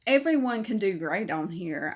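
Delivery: usual speaking voice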